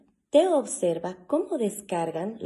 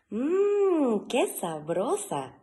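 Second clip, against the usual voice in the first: savouring; 6 semitones higher, 3 dB louder, much slower than usual. Acted. Teo savouring a stolen carrot.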